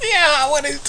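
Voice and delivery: high, laughing "yeah"; still laughing. Real, not acted. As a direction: keep the laugh going.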